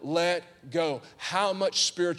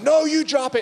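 put-on bratty kid retort; 11 semitones higher, 7 dB louder than usual. [name] answers brother.

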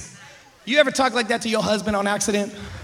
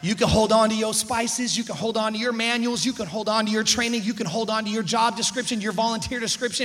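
usual speaking voice

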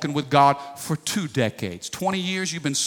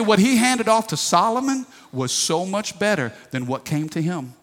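normal talking voice

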